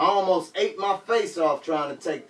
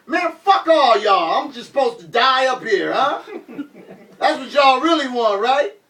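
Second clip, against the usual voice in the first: angry outburst; 8 semitones higher, 6 dB louder than usual. Retold or imitated. Injured rapper lashing out at the crowd, angry yell. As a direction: lash out at them with a bitter laugh.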